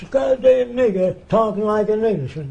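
imitated indignant outburst, pitched high; mock high-pitched exclamation of disgust; Lou's reaction. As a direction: act out a Klansman's startled outrage. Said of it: "This is Lou's reaction".

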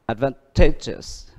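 advantageous slowly, stress on TA; teacher's slow model of advantageous, TA stressed; stress on third syllable. Shown as a demonstration. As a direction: draw out advantageous, leaning on the TA. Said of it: "Vậy thì nó nhấn âm ở".

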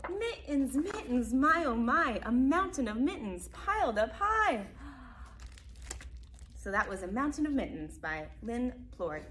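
lively picture-book narration; reading to children. A narrator's reading. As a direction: read it out brightly to small kids. Reads picture book aloud.

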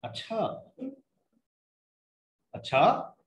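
lightly imitated film catchphrase, "अच्छा" lilting upward; a hint of a puzzled movie character; Aamir Khan. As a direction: stress "अच्छा" and lift its ending. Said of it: "Aamir Khan का पीके के अंदर".

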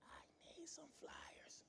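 slow, breathless whisper; sick woman whispering a request to her pastor. Retold or imitated. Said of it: "She was whispering".

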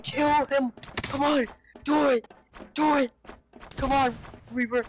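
high excited shouts; excited yelling. Real, not acted. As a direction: cheer him on, yelling. Cheering on a fight.

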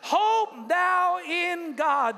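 slow, loud, high preached line; preacher leading the congregation to repeat, slow and loud; call and response. Shown as a demonstration. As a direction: draw it out for the room to repeat. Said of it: "Say that with me".

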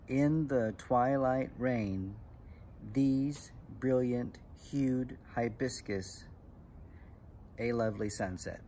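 slow, deliberate, pausing after each line; measured poem recital. Shown as a demonstration. Teacher reading a famous haiku to kids, slow and measured. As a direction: recite it word by word.

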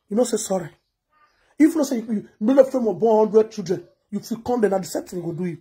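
ordinary talk, nothing marked

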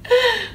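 high surprised gasp; sharp gasp. Real, not acted. Sudden gasp.

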